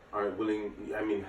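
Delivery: ordinary delivery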